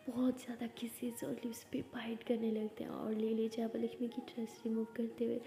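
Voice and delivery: soft, hushed storytelling of the kiss; quiet, close narration of the love scene; hushed intimate narration. A narrator's reading.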